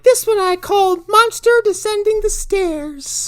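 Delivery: very high, drawn-out loud mock voice; hammed-up sculptor unveiling her piece; imitated arty mom. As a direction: announce your artwork in a slow, high falsetto. Voicing the sculptor mom's artwork reveal.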